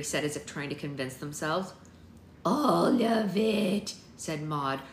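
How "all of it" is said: very slow, high, loud crone voice; old seer's lament. A narrator's reading. Maud answers Avery about the ended knitting, ominous. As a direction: stretch every word out ominously.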